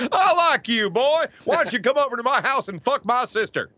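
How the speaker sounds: a laughing, hammed-up Full Metal Jacket drill sergeant. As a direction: bellow at the recruit like a Marine drill instructor. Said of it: "I flashed back on Full Metal Jacket".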